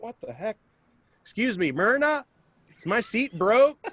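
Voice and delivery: hammed-up confused passenger; mock bewildered self. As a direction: act baffled at the broken seat. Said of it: "I was like".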